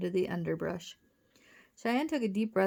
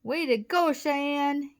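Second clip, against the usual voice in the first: high, raised congratulation; cheering yell; 7 semitones higher, 5 dB louder, slower than usual. A narrator's reading. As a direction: cheer your sister on. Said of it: "yelled Wally".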